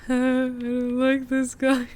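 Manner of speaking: slow, high mock-scared whine. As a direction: drag it out, spooked. Spooked by the duck.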